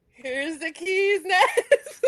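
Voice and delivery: laughing through the words; slow, giggling line. Retold or imitated. Laughing retelling of a shared joke.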